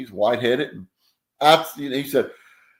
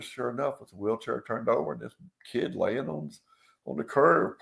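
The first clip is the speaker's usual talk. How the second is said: ordinary talk, nothing marked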